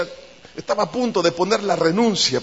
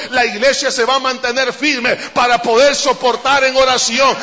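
building louder into full-force preaching; fervent preaching; 3 semitones higher, 4 dB louder than usual. Acted. Pastor rousing the congregation about persecution, loud preaching. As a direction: let it swell into a rousing sermon.

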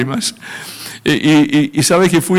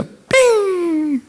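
high, sharp arrow sound; zing it like an arrow hitting; arrow ping. Acted. Preacher mimics God's arrow striking him with a high ping.

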